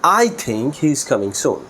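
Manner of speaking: I punched and stretched at the start; stress on I. Shown as a demonstration. Teacher shows it is their own opinion, stress on I. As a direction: land hard on the opening word I. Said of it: "I এর উপরে যদি আমি stress দিয়ে".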